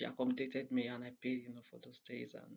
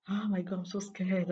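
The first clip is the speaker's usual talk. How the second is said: high, loud mock panic; mock fear; 6 semitones higher, 7 dB louder than usual. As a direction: ham up her fright. Quoting friend's nervous excuse.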